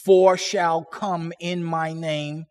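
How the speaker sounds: slow, deliberate reading in chunks; measured literal recitation, leaning on each phrase opening; word-for-word reading. Shown as a demonstration. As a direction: read it out slowly, pausing after the first word. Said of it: "reads like this".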